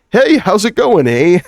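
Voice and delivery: put-on high, loud Canadian accent, eh; chirpy fake Canadian accent with a laugh; American faking a Canadian accent; mock Canadian accent. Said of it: "A few years later".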